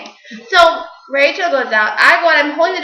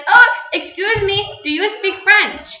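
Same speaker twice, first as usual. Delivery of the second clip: slow, higher, badly faked French accent; mock French accent; 6 semitones higher, slower than usual. A stranger chatting up the girls in mock French accent. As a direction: ham up a fake French charmer's accent. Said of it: "forget the stupid accent".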